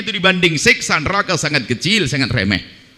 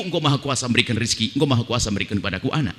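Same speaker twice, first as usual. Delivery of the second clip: soft, quick, murmured believer's prayer; a soft murmured supplication; humble prayer; 6 semitones lower, 6 dB quieter, faster than usual. Retold or imitated. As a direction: pray it gently and trustingly. Voices a faithful believer's humble prayer.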